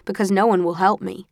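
plain reading voice

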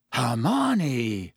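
awed, dropping voice; a gasp of disbelief and admiration; stunned and impressed. A narrator's reading. Ron reacts to Hermione's slap, stunned and impressed. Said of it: "sounding both stunned and impressed".

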